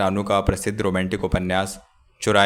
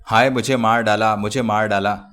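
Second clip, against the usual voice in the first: ordinary delivery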